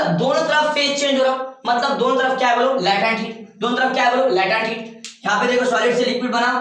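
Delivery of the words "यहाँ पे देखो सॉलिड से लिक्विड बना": normal talking voice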